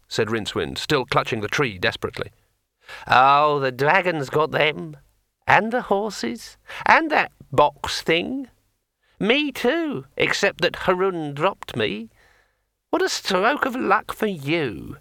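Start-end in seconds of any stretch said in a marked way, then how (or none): 3.1-15.0 high, slow, chatty sword voice; a cheerful magic sword rambling on; talking sword. A narrator's reading. Sword Kring speaks.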